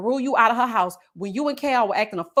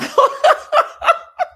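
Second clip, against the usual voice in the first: high, loud laugh; real, pealing laughter; burst of laughter; 8 dB louder than usual. Real, not acted. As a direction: break into a big high laugh. Laughs at comment on her accent.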